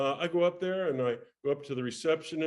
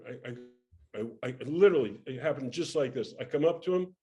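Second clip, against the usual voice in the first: ordinary talk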